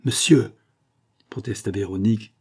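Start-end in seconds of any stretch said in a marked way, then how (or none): 0.0-0.5 higher, louder indignant objection; indignant protest. A narrator's reading. Insulted wife protesting to her husband, indignant. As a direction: cut him off, affronted. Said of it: "indignée".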